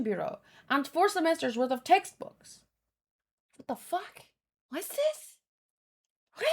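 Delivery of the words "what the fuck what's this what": a sudden high squeak of disbelief; shocked disbelief. Real, not acted. Reader stunned by the pile of paperwork, shocked squeak. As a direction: caught off guard, voice jumping up.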